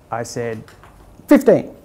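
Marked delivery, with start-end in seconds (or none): 1.3-1.7 high and loud, then bursting into laughter; blurting a bold number, then a real laugh; cheeky answer, then laughing. Real, not acted. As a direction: toss out the big number and crack up.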